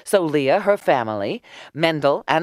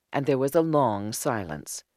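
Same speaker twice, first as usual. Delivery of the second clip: plain reading voice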